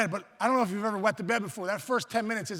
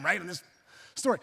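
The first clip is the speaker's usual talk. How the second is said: ordinary talk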